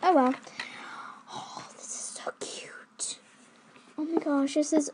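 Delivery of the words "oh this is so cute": hushed whisper of delight. Real, not acted. Admiring the toy, a hushed whisper. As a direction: breathe it out under your breath. Unvoiced aside over toy.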